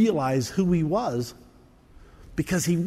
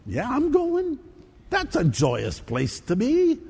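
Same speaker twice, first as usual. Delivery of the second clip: sarcastic; 3 semitones higher than usual. Pretend. Sarcastic mock churchgoer reply.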